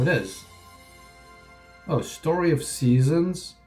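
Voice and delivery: ordinary delivery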